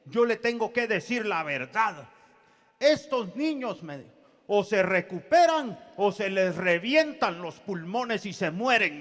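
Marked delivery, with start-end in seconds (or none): 0.0-3.8 high, loud, emphatic retelling of a doctor; imitated doctor. Doctor warning the baby's father, imitated with raised emphasis. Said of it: "el doctor me dice estas palabras". 4.5-9.0 loud, emphatic mock pronouncement; imitated doctor. Doctor giving the father a grim prognosis, imitated forcefully. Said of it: "el doctor me dice estas palabras".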